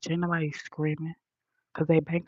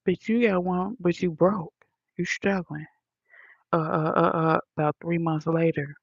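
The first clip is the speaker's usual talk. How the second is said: ordinary delivery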